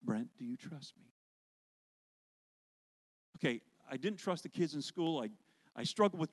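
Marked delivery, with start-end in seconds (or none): 0.0-1.1 lightly lower, softer voice of God; gently ask, calm and low; God's voice. Retold or imitated. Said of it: "this same voice".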